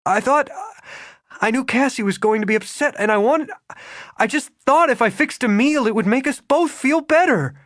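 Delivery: high, fast, rushed explaining; Regan, flustered. A narrator's reading. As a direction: tumble the excuses out nervously.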